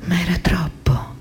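voiceless, breathy whisper. Acted. A woman recalls her moment of realisation, whispered. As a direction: let it out as a bare whisper. Hushed line in acted monologue.

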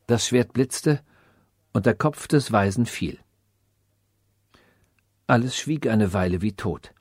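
usual speaking voice

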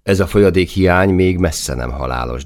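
plain reading voice

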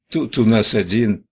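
plain reading voice

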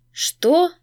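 sharp high startled question; shocked. A narrator's reading. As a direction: jolt upward in disbelief. Vampire shocked by news.

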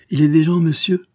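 loud, high, proud retort; reckless pride. A narrator's reading. Young woman answering the agent's warning, proudly defiant. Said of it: "un beau geste de téméraire fierté".

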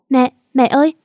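high, loud frightened cry for mom; a little girl screaming for her mother; panicked child cry. A narrator's reading. Girl sees her mother bleeding, panicked high cry.

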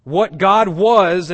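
loud, high, slow WHAT GOD WAS; emphatic stress. Retold or imitated. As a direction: punch each word of WHAT GOD WAS. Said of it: "He's stressing the essence and quality".

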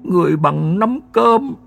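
put-on high, slow, frightened old man's voice; old grandfather telling his son about the ghost, high and slow with fear; shaky old man's fright. A narrator's reading. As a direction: gasp it out like a terrified grandfather. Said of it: "Mấy giây sau mới thều thào".

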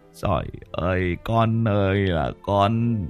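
put-on old father muttering in fear; trembling mumble. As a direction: moan it under your breath in dread. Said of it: "Ông lẩm bẩm run rẩy".

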